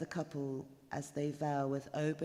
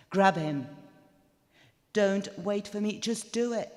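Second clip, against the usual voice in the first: urgent command; 5 semitones higher, 8 dB louder than usual. A narrator's reading. Narrator urging to grab intruder.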